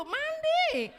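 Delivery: high, loud mock delight; eager exclamation. Wife thrilled at the mall trip, high and loud.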